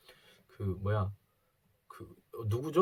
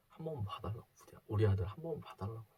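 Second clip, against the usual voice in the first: pleading mother; 2 semitones lower, 8 dB quieter, faster than usual. Retold or imitated. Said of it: "그렇게 막 싹싹 비시는 거예요".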